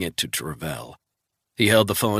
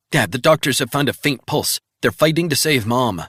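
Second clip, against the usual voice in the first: put-on higher, urgent young voice; anxious daughter; 6 semitones higher, 6 dB louder than usual. Daughter calling Resnick from the hospital, anxious. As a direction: daughter phoning her father in a panic.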